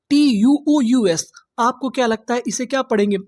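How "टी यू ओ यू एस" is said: slow letter-by-letter spelling; spelling out letters slowly. Shown as a demonstration. Teacher spelling out each letter slowly for students. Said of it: "गौर करना है special शब्दों के समूह पर".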